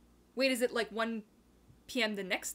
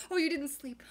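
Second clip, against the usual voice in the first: ordinary talk, nothing marked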